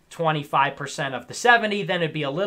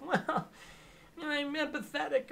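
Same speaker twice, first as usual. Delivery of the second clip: put-on small, high, soft babyish voice; mocking crybaby; 9 semitones higher, 11 dB quieter than usual. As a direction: mimic a feeble, babyish man. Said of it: "Goo goo gaga nibbas be like".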